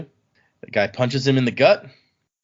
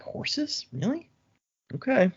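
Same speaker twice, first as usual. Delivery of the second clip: incredulous whisper; 13 semitones higher, 6 dB quieter, slower than usual. Retold or imitated. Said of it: "he's like".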